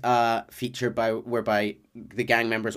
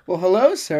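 polite townsfolk reply; 6 semitones higher, 6 dB louder than usual. Retold or imitated. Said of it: "and they go".

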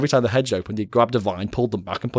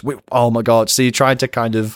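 ordinary talk, nothing marked

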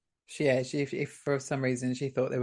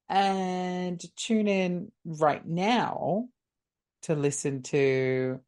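slow announcer drawl; 3 semitones higher, much slower than usual. Acted. Drawn-out sign-off.

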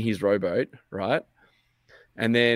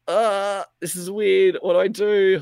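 higher, louder flustered worry; panicky; 9 semitones higher, 4 dB louder, slower than usual. Retold or imitated. Hiding on a stranger's ship, flustered higher panicky worry. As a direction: caught out and unsure what to do.

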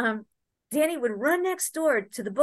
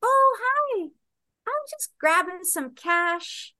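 much higher, slow fake-casual cover-up; mock innocent; 7 semitones higher, slower than usual. Caught at the cabinet, answering her partner in high fake innocence. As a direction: act like nothing's wrong, sweetly. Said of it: "And I go".

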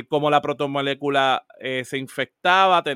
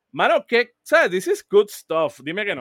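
higher, louder burst of real excitement; enthusiastic; 6 semitones higher, 4 dB louder than usual. Real, not acted. Fan raving to co-host about a show, excited. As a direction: rave about it, pitched up and punchy.

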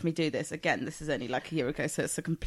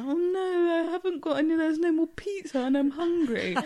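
put-on whiny kid; 10 semitones higher than usual. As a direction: whine about the lost pizza. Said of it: "he was like".

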